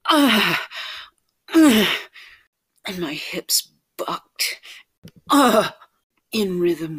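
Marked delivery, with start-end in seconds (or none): 0.1-1.9 two loud, high moans; short, raised moans of pleasure; pleasured moans. Acted. Narrator acts out moaning. 5.3-5.7 loud, high moan; short burst of pleasure; pleasured moan. Acted. Narrator acts out moaning.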